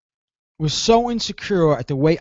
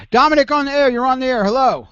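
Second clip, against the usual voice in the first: radio host intro; 8 semitones higher, faster than usual. Acted. Radio host picks up a call.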